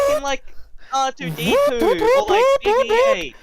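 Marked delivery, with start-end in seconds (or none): 2.3-3.4 droid voice. Pretend. Silly squeaky droid voice.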